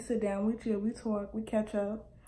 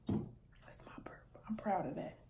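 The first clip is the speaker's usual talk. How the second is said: whispered; 3 semitones lower, 15 dB quieter than usual. Real, not acted. Proud aside.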